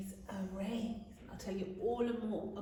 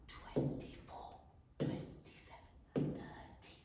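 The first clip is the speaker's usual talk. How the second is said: whispering the count along; whispered counting; 12 dB quieter than usual. Shown as a demonstration. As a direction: whisper each number, barely there. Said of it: "I'll whisper it".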